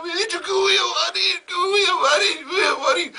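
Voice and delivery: comic high wailing shout; hammed-up crying shout. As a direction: howl it like a hysterical maid. Maid wailing again.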